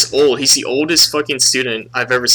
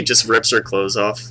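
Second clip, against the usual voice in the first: normal talking voice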